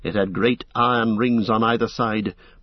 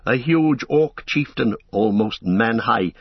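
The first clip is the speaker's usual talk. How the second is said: ordinary delivery, nothing marked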